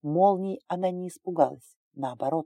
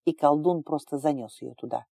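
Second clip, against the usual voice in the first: ordinary delivery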